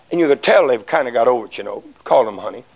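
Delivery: ordinary talk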